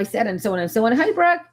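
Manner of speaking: normal talking voice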